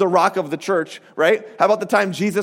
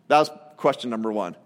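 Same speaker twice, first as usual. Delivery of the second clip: normal talking voice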